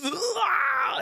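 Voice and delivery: short high yelled ah; mock scream. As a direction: yell it out as the dying man.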